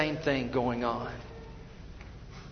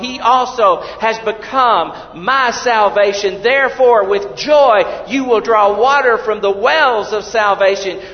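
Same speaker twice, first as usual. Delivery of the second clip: loud, high, ringing reading; proclaimed scripture; 6 semitones higher, 14 dB louder than usual. Acted. Preacher reading Isaiah twelve to the congregation, ringing proclamation. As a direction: declare the verse to the whole hall.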